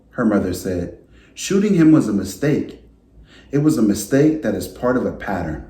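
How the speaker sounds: gentle storybook read-aloud voice; reading to children. A narrator's reading. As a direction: read it like story time for a class. Teacher reads picture book aloud.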